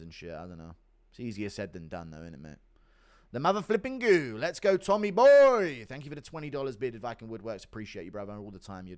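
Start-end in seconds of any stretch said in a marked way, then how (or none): 3.3-5.9 hype cheer. Acted.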